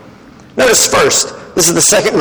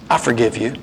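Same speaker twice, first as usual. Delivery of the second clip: lower, softer, tender pardon; gentle forgiveness; 8 semitones lower, 6 dB quieter, faster than usual. Pretend. Preacher pretending to forgive Chris at potluck, softly. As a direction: say it quietly as a calm absolution. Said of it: "And I look over at Chris and I say".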